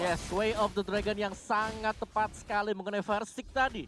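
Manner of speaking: high, loud hyped commentary; excited play-by-play. Acted. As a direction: sell the big play with energy. Co-caster hypes the team fight.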